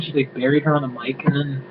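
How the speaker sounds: ordinary talk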